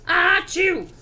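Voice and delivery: mock sneeze, a stretched "ah-choo"; acted sneeze. Wolf with a cold at pig's door, acted sneeze. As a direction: fake a big sneeze. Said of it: "I sneezed a great sneeze".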